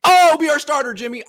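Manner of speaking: high, loud, overeager plea; mock eager pitch. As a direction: gush like a desperate team. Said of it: "be like".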